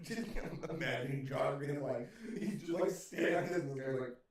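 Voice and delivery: ordinary talk, nothing marked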